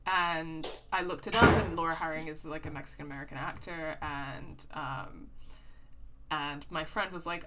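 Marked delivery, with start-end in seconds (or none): none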